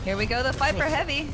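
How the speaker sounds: high, loud hype call; excited hype. Real, not acted. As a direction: build up the big fight. Caster hypes big fight.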